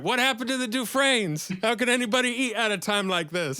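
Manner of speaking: high-pitched, hammed-up protest; mock outrage. Fan acting out a comic's bit, mock outrage. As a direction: demand answers in mock dismay, pitched high.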